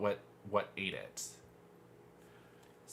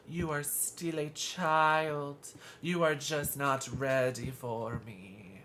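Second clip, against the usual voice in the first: put-on slow, higher, haughty made-up Italian-ish accent; Excella's made-up accent; 4 semitones higher, slower than usual. Fan quoting her favorite villain Excella, slow made-up accent. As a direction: purr it slowly like a condescending villainess. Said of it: "her accent just doesn't exist".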